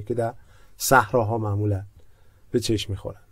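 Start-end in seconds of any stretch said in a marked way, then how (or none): none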